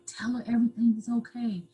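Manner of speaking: hushed, pressing whisper. Retold or imitated. Abuser whispering to her that everything is okay. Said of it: "I kept whispering it to me".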